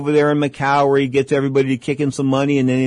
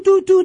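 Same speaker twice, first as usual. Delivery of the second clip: high sung whistle toot; imitated train whistle; 6 dB louder than usual. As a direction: copy the train whistle with your voice, high and loud.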